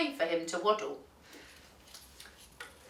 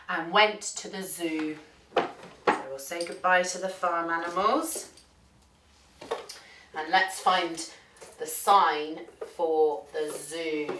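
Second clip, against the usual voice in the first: plain reading voice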